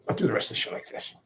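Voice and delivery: very soft, quick, near-whispered delivery; quiet, quick whispery murmur; hushed voice. Shown as a demonstration. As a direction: rush it under your breath.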